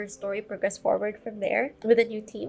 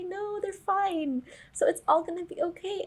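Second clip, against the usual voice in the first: mock relieved reassurance; 8 semitones higher than usual. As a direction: talk yourself into calm, in a high voice. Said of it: "every episode I always think".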